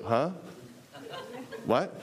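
puzzled mock reply with a steep rise; imitated bafflement. Church staff answering an eager tither, imitated bafflement. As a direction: swoop up on each word, baffled.

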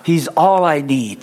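slow, weight on all; slow call to repeat. Shown as a demonstration. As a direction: lead them to echo it. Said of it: "everybody say this after me".

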